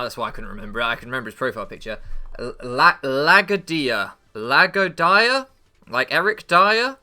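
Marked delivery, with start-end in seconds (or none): 3.0-4.1 Lagadia, slow and loud, sounded out; uncertain pronunciation. Shown as a demonstration. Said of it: "don't know how to pronounce his name". 4.3-7.0 "Lagodia" slow, high and loud, ending like Dyer; questioning pronunciation. Shown as a demonstration. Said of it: "don't know how to pronounce his name".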